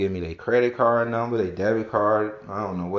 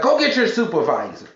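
demanding customer; 8 semitones higher, 7 dB louder than usual. Retold or imitated. Caller demanding a supervisor.

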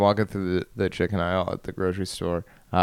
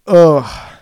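loud frustrated groan; 8 semitones higher, 12 dB louder than usual. Real, not acted. Frustrated outburst at himself.

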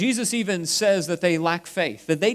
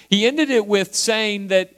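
ordinary talk

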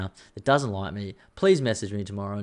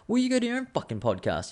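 sharply raised, indignant comeback; defensive retort; 13 semitones higher than usual. Retold or imitated. Retold snap at a friend's feedback, indignant and high. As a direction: bristle back at the critic, pitch shooting up. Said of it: "I was like".

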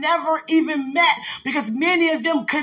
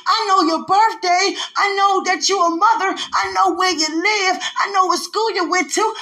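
full-force preaching; 5 semitones higher than usual. Acted. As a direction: shout it like a Sunday sermon.

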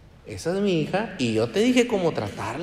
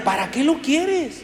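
incredulous question; 13 semitones higher than usual. Acted. Questioning the greedy believer.

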